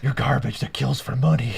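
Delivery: gravelly Batman growl. Acted. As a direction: rasp it out like Batman. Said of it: "I'm, like, destroying my throat".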